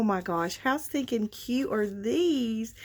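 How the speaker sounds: normal talking voice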